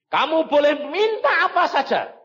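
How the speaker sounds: loud, high-pitched proclamation; booming divine offer. Retold or imitated. God's grand offer, retold.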